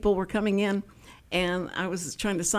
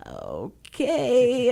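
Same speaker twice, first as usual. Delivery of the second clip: reluctant okay; 3 semitones higher than usual. Retold or imitated. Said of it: "And I went".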